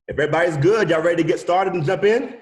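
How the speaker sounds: loud and high, rallying the room; calling out. Real, not acted. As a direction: rouse the class to begin. Calling the class to start.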